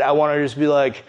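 ordinary delivery

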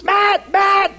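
high frantic yell of the name; imitating a worker screaming for help; panicked scream.

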